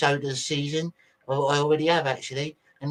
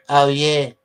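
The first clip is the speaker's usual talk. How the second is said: louder, imitating a shouting fan; mock shout; 7 dB louder than usual. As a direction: call it out like the guy in the video. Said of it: "The guy in the video shouting".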